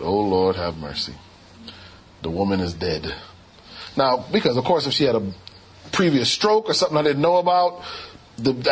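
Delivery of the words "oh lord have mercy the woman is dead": a breathless, hushed oh Lord; low despairing murmur. Retold or imitated. Doctor watching the patient flatline, low hushed despair. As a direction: drop low and soft, hushed dismay.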